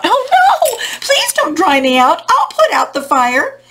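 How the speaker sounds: high, loud panicked plea; hammed-up cry for mercy. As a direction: plead in alarm as the storybook water. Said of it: "the water cried out".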